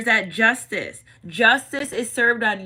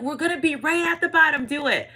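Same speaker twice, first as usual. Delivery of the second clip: higher, lightly acted encouragement; urging; 6 semitones higher than usual. Friends urging a scared diver to jump, eager encouragement. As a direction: egg on a nervous friend, pitched up.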